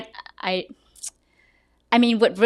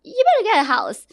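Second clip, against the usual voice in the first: amazed excitement; 6 semitones higher than usual. Retold or imitated. Reacting to a low loan rate.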